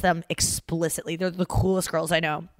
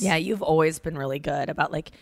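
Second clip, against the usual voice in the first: normal talking voice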